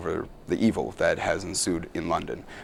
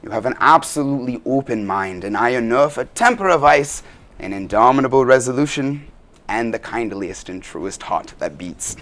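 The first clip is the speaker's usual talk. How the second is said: high, theatrical Dutch accent; Dutch-accented stage speech, slowing at the end; 6 semitones higher, 6 dB louder than usual. Shown as a demonstration. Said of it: "Could you give us a little example of your Dutch accent?".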